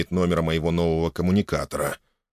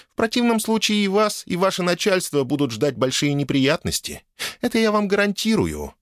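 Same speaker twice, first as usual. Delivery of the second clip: lightly voiced icy queen, higher pitch; a hint of imperious frost, pitched up; ice queen; 7 semitones higher than usual. A narrator's reading. Wife warns the official of trouble, icy queen manner. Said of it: "мгновенно преобразившись в ледяную королеву".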